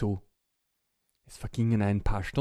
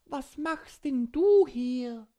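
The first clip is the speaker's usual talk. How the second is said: slow, raised friendly witch; 12 semitones higher, 4 dB louder, slower than usual. Acted. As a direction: play a kindly witch. Witch asks.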